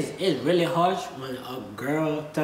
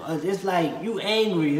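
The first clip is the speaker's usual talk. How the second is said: ordinary talk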